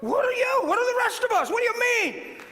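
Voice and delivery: loud, high-pitched impassioned preaching; fired-up pulpit cry, voice pushed way up; preaching at full force. Real, not acted. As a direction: challenge the congregation at a shout.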